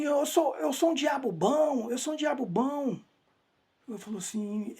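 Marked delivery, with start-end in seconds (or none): 0.0-3.0 high, drawn-out imitation of a yell; retold yelling. Disturbed father crying out from afar, imitated high yell. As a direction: act out his cries with raised pitch. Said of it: "ele gritava assim".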